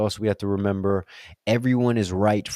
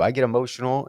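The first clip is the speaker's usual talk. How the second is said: usual speaking voice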